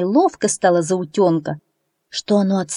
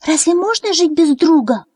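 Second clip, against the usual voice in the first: much higher astonished peep; surprised chick; 10 semitones higher, 4 dB louder than usual. A narrator's reading. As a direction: ask in wide-eyed disbelief. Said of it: "удивился цыплёнок".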